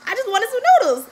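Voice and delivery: high, fast, loud noodle craving; excited burst about noodles; craving whine. Real, not acted. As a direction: blurt it out like a sudden craving.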